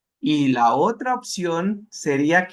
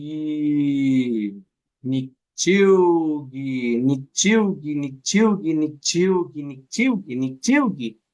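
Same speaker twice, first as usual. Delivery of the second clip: Nichiugi drawn out very slowly at first, then repeated quicker and smooth; slow then faster drill; 3 semitones lower, slower than usual. Shown as a demonstration. Teacher shows the class how changing speed reveals the smooth word. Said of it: "Díganlo lento, díganlo rápido".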